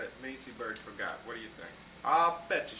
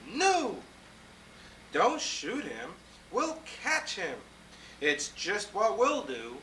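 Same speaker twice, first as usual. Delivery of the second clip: higher, eager exclaiming; excited hunters; 7 semitones higher, 4 dB louder than usual. Acted. Hunters amazed at the elephant, excited exclaiming. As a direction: gush with amazement and greed. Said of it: "they all shouted".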